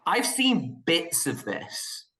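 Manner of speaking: ordinary delivery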